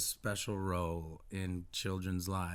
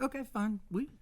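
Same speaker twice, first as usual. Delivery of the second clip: much higher, feigned-casual motherly voice; imitated French mother. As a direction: play Mom pretending it's no big deal. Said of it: "And she was like".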